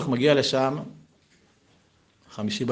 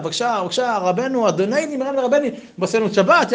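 higher, eager begging voice; pleading host; 10 semitones higher, 3 dB louder than usual. Acted. Husband begging the rabbi to stay for Shabbat, eager and higher. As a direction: fawn over the guest, higher. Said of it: "מוטל אומר לו".

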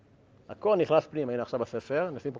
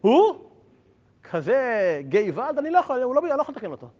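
much higher, louder cry of dismay; exasperated outcry; 8 semitones higher, 5 dB louder than usual. Retold or imitated. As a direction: throw up your hands, gevalt. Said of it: "אומר רבי נתן".

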